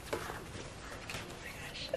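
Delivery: ordinary delivery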